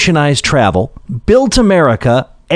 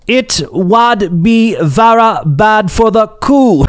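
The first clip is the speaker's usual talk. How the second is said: slow, high put-on Northumberland accent; hammed-up Northumberland farmer drawl; 7 semitones higher, slower than usual. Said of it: "with his thick Northumberland accent".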